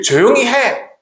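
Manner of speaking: a sharp hushing rebuke; mock scolding. Crowd rebuking the blind beggar, mock scolding. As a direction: tell the beggar to shut up.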